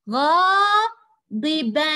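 غاضبا very slow and loud, ending on a held "n"; tanween sounded. Shown as a demonstration. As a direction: stretch غاضبا syllable by syllable into a long final "-ban". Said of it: "هذا هو التنوين".

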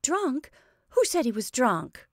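high, indignant challenge; furious. A narrator's reading. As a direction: pounce on the word "Drunk". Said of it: "Laura turned furiously on Josie".

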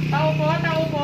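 loud call toward the house; calling from far away. Real, not acted. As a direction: call out to whoever's inside.

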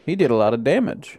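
ordinary talk

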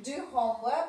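normal talking voice

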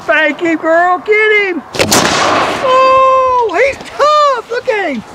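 high whooping cheer, urging her on; excited cheering. Real, not acted. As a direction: egg her on with glee. Cheering on the shooter.

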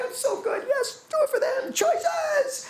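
hammed-up tearful wail, very high; mock crying. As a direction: replay his own sobbing in a squeaky pitch. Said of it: "tears coming out of my face".

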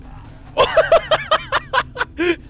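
a high burst of laughter at the ride; giddy high laugh; high delighted laugh. Real, not acted. Delighted at the ride's start.

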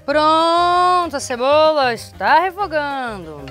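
high, loud, drawn-out proclamation; playful loud announcement. Pretend. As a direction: announce it grandly, stretching the first word. Mock announcement to the camera. (anúncio brincalhão, alto e esticado)

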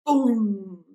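loud mouth-made boom; mock explosion. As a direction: shout a big boom. Robot explosion sound effect.

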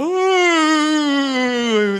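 put-on high, drawn-out bored teen groan; high whiny groan of a bored teen; mock whiny groan.

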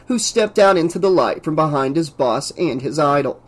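ordinary delivery

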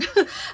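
short, high, loud giggle; sudden amused giggle; laugh. Real, not acted. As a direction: burst into a brief laugh. Amused after a long hesitation.

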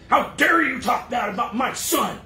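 hammed-up furious mother; retold shouting, half-hearted fury; imitating a scream. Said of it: "went ballistic and screamed".

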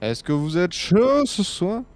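hype announcer. Acted. Hyping the stream audience.

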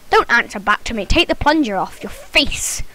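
soft, low stern whisper; whispered mock telling-off; mock scolding whisper. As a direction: hiss it like a strict parent. Scolding pretend voice at character.

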